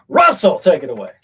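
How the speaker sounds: loud rising call of Russell; loud hand-off to cohost; hype call. Real, not acted. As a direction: toss it over like a show host.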